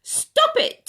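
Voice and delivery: very high outburst; high shouted protest; shouting. Acted. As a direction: shout like cross sisters. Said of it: "they both shouted".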